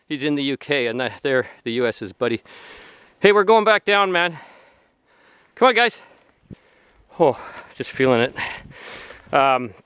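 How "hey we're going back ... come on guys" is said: high-pitched call along the trail. Real, not acted. Walker calling companions back down the trail, calling out. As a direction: call the group to come along.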